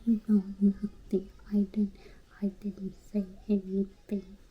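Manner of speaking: lightly done whispered broken-English denial; Custis Felix impression. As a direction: whisper "Me know nothing" like a scared witness. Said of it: "Like how Custis Felix sound".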